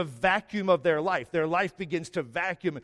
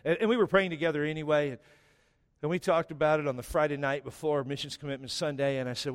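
usual speaking voice

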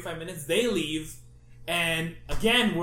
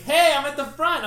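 calling out; 8 semitones higher, 8 dB louder than usual. Retold or imitated. Sister calls from the line.

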